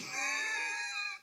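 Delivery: high, loud real laugh; shrieking laugh. Real, not acted. Laugh burst.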